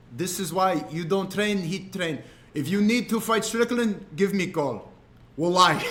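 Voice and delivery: coaching in a put-on Dagestani accent; imitated Dagestani coach. Fighter advising streamer on Strickland, imitated Dagestani accent. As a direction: lecture like a stern Dagestani trainer. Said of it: "He was speaking to me the way".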